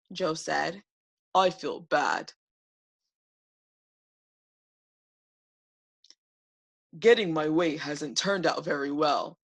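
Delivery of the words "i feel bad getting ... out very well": sick sorry dragon. Acted. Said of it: "He felt so horrible and sad".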